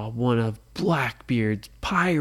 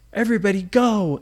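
urgent shout; 7 semitones higher, 7 dB louder, faster than usual. A narrator's reading. Kids urging escape.

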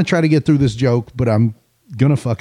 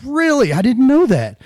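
high-pitched acted surprise; surprised; 10 semitones higher than usual. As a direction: jump up in pitch, taken aback. Pastor hears the fish's name.

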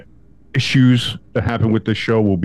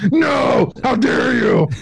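mock outraged shout; 13 semitones higher, much slower than usual. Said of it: "where I just screamed at this lady".

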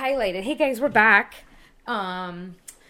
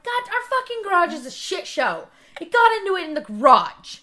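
raised, exasperated outburst; retold exasperation; 8 semitones higher, 7 dB louder than usual. Wife to Chris about their garage, retold exasperation. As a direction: act out venting about the mess.

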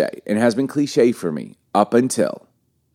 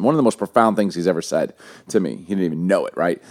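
normal talking voice

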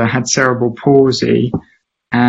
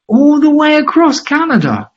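very high, louder astonished question; amazed; 13 semitones higher, 3 dB louder than usual. Retold or imitated. Dad amazed at the Terry Fox story, very high. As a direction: squeal it in disbelief.

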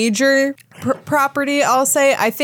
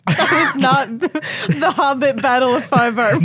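laughing correction; 3 semitones higher than usual. Real, not acted. Guest corrects the host's mangled movie title while laughing.

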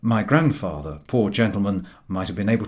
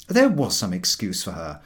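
plain reading voice

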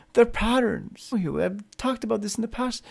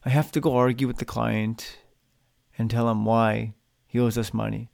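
reluctant grumble; 9 semitones lower, 3 dB quieter than usual. Shown as a demonstration. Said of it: "See how things change".

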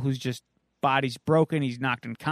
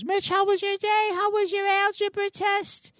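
much higher, sweet motherly falsetto; mom voice. Retold or imitated. Mother greets her teen son, high sweet mom voice. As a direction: chirp it like a cheery, fussing mom. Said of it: "She goes".

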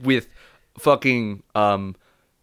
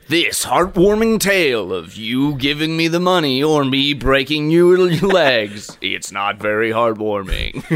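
movie-trailer henchman. Acted. Henchman threatening to break legs, trailer voice. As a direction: sell a threat like a film trailer. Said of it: "henchman who talks like it's a movie trailer".

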